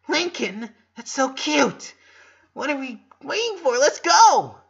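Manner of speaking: high, loud, building excitement; thrilled. A narrator's reading. Luna gushing excitedly at her little brother. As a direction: gush like an overjoyed big sister.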